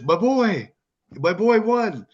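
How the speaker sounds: put-on excited call; mock excited announcer. Retells commentator's exclaimed line.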